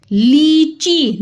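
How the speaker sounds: लीची very slow, both ई held long; slow demo. Shown as a demonstration. Teacher demonstrating two long ई in लीची. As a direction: draw out ली and ची in लीची. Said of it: "दो ई की मात्रा आ रही".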